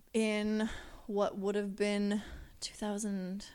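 ordinary talk

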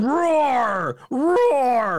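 high, loud, drawn-out mock roar; imitated mascot roar. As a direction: roar like a child playing monster. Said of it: "He's out there going".

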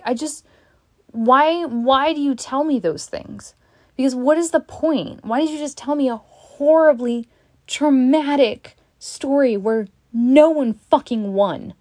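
retold outburst, demanding to know why; upset confrontation. Confronting the person who told the traumatic story, upset outburst.

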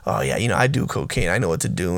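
lightly imitating a little boy trying to sound hard; boy acting tough. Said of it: "he's telling her".

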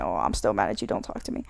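hushed, low mock grumble; pretend aggravation. Speaker to their partner while amused, quietly feigning aggravation. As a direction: grumble it softly, pretending to be mad. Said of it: "act like I'm still aggravated".

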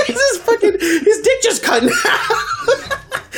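high, helpless laughter through the words; barely able to speak for laughing; laughing hard. Real, not acted. Telling friends about the snapped hot dog, laughing.

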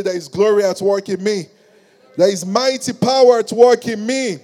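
loud, building proclamation; rousing declaration. Real, not acted. Preacher leading the congregation to repeat, rousing and loud.

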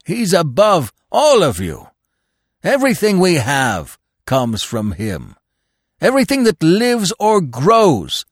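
high, loud, zealous proclamation; exultation. A narrator's reading. Dog glorifying his master to the animals, high exultant cry. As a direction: ring it out in rapturous devotion. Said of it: "The dog was quivering with exultation".

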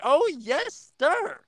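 high, bright mock-gleeful cheer; delighted exclamation. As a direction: squeal it like a thrilled fan. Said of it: "And I was like".

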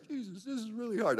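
mock whiny plea to Jesus; whining. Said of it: "I'm whining".

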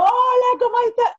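shouted high cheerful hello; loud Venezolano greeting. Retold or imitated. Imitating a loud Venezuelan greeting a friend. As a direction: belt the greeting out. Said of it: "El venezolano suele hablar durísimo".

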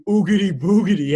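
a touch of the retold scream; a hint of the chaser's yell, mid-story; mild mock scream. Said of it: "is screaming".

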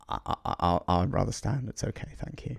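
soft, halting and trailing to nearly nothing; awkward polite refusal. Acted. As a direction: decline quietly, stumbling at the start. Voicing Aragog awkwardly declining a seat.

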